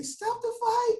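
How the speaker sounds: very high, strained mock shout; high-pitched comic plea; Rocky movie cry. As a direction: cry out like a desperate cornerman, hammed up. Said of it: "when they say".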